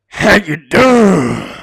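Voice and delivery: very slow, loud, stretched and falling; drawn-out catchphrase. Acted. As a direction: drag each word out like a sign-off. Catchphrase repeated at intro end.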